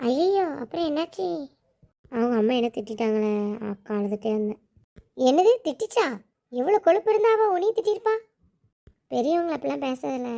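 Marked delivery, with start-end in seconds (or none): 5.3-8.1 higher, louder indignant outburst; outraged disbelief. Acted. Brother hearing sister was scolded, indignant outburst. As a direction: bristle on her behalf.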